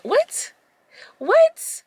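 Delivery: very high, rising "What? What?"; reliving the moment she heard it; shocked disbelief. Real, not acted. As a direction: squeal it in stunned disbelief. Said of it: "her own disbelief at cash remark".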